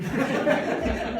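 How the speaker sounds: short embarrassed laugh. Real, not acted. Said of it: "Hindi ako galit".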